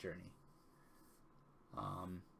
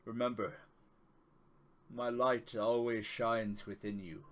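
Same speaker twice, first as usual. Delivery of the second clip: solemn, fatherly god speaking; Tyr's voice. Acted. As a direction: gravely reassure your chosen one. GM resumes the god's speech.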